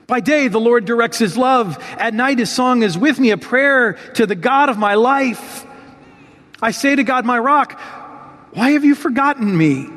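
psalm reading. Acted. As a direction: read it as David's own lament, pleading. Reads the psalm as David.

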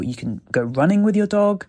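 normal talking voice

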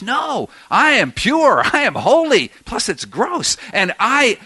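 self-righteous refusal, acted out; indignant Peter. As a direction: insist on each claim, pitch swooping up.

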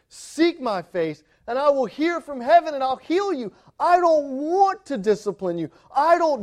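impassioned pulpit cry as God. Acted. God pleading with the church to turn, crying out. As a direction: call out in drawn-out, urgent phrases. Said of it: "God is crying out to you".

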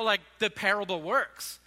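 usual speaking voice

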